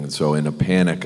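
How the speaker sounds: ordinary delivery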